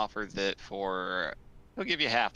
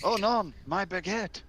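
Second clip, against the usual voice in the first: put-on French accent; 10 semitones higher than usual. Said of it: "It's like".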